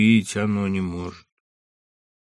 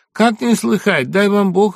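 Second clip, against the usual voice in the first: bright congratulations; 12 semitones higher, 7 dB louder than usual. Acted.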